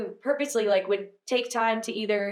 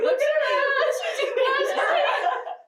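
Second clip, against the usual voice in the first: very high, loud, slow laughing mock voice; mocking neighbor; 4 dB louder, much slower than usual. Teasing a friend by voicing a neighbor, laughing. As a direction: squeal it through laughter as a nosy onlooker. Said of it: "She's like".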